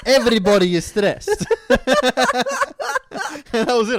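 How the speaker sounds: words broken by laughter; laughing. Real, not acted. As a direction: laugh through the line. Laughing through retelling.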